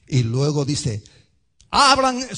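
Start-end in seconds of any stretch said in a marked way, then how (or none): 1.7-2.4 loud, high-pitched call; herald crying out. Acted. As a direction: cry it out as a herald. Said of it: "soy esa voz".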